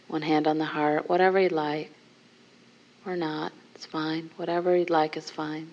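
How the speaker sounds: guided meditation. Acted. Teacher guiding the group into a seated contemplation, softly. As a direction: soften and guide them gently inward.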